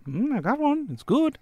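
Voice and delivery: high-pitched enticing pet talk; coaxing. Acted. Prisoner shows pig the rat, high coaxing voice. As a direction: make it sound tasty to an animal.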